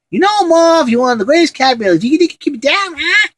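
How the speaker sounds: high-pitched needling crook; Joe Pesci. Acted. Movie burglar to his partner Marv, high and irritated. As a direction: squawk it at your partner in crime. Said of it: "Joe Pesci's just funny in that one".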